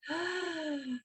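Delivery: quick high gasp through the mouth; snatch the breath in through the mouth; gasping inhale. Shown as a demonstration. Teacher demonstrating the mouth inhale for the class, sharp gasp. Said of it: "Breathing in through the mouth".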